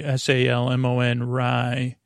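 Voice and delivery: S A L M O N spelled letter by letter, slowly. Shown as a demonstration. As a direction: spell out S A L M O N, then say Rye. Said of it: "Salmon Rye".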